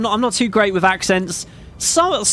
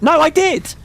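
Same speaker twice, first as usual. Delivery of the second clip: sudden realisation; 10 semitones higher than usual. Real, not acted. Correcting himself mid-memory.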